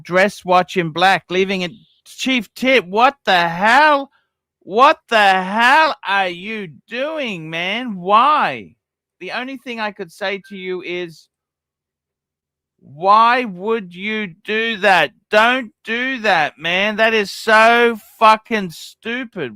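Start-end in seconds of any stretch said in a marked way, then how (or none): 2.1-8.8 slow, drawn-out exasperation; incredulous scolding, dragged out slowly; exasperated disbelief. Real, not acted. Berating a chat member's purchase. 12.8-19.5 slow, emphatic telling-off; pleading reproach, heavily stretched; exasperated scolding. Real, not acted. Berating a chat member's purchase.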